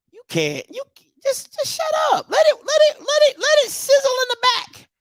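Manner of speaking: squeaky falsetto, way up high; mock high voice. Mocking the hype machine in a squeaky high voice. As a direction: pitch it way up like a cartoon.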